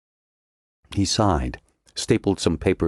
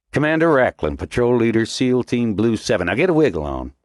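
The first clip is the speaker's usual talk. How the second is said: commanding officer; 6 semitones higher than usual. A narrator's reading. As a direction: snap out rank and unit. Commander introduces himself.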